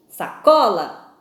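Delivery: "Sacola" slowly, with a strong stretched "co"; "Sacola" drawn out, middle syllable stressed; stress on second-to-last syllable. Shown as a demonstration. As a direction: lean hard on the CO of "Sacola". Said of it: "a penúltima sílaba é a tônica".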